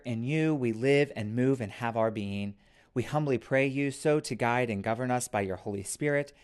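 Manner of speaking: ordinary talk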